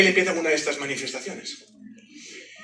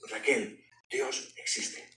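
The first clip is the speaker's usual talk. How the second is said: susurro asombrado; 5 semitones lower, 9 dB quieter, much slower than usual. Retold or imitated. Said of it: "la primera frase que le dije".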